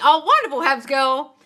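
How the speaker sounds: gushing delight. Real, not acted. Gushing to a viewer's comment. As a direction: beam the praise out.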